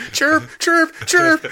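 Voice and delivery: very high chirping bird voice; canary chirp. Pretend. Imitating canary in mine.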